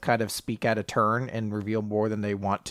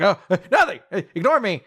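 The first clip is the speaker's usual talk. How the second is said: high, loud embarrassed backpedal; acted nervous cover-up; flustered brush-off; 8 semitones higher, 5 dB louder than usual. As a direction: laugh it off after a slip.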